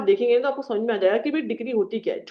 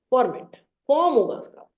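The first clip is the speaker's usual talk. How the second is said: usual speaking voice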